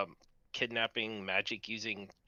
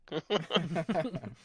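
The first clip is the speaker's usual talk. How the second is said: parrot squawk laugh; 6 semitones higher, 4 dB louder than usual. Pretend.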